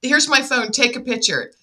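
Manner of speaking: high, loud, excited pleading; retold urgent insistence. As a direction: rush to get your brother on board, acting it out. Retold urgent plea to brother.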